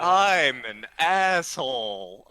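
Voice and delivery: slow, drawn-out dismay; mortified self-reproach. Retold or imitated. Storyteller learning she's actually British, slowly mortified. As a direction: sink into embarrassed realization, stretching the words.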